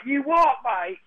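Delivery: high, mock English football-lout accent; hammed-up English yob slurring threats; drunken English hooligan. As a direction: rant like a drunk English soccer fan spoiling for a fight. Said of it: "just drunken lunatics rambling shirtless through".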